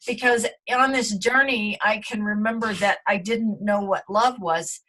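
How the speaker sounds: ordinary talk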